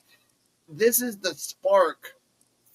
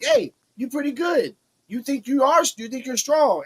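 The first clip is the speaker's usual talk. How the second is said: much higher mock taunt; imitating Kelly Armstrong; 9 semitones higher than usual. Kelly Armstrong taunting Kendall, high mocking voice. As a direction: sneer it up high like a heel. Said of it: "Kelly Armstrong's like".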